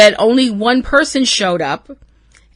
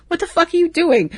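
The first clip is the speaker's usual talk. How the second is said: high, disbelieving mock question; a hint of baffled Sam; imitating incredulous Sam; 10 semitones higher, faster than usual. Sam staring at Bobby's jargon, a mock baffled question. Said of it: "Sam's kind of look at him like".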